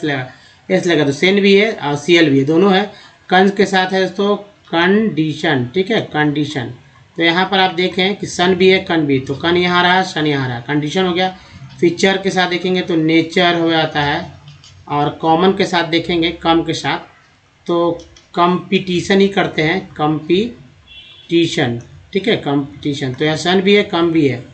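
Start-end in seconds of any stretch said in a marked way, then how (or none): 4.4-5.7 condition slowly broken into कन-डी-शन; syllable by syllable. Shown as a demonstration. Teacher splits word into parts. 19.9-21.8 competition slowly, with pauses between parts; syllable by syllable. Shown as a demonstration. Teacher splits word into parts.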